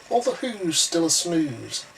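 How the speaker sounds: plain reading voice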